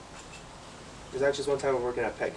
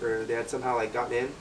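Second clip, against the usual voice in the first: ordinary talk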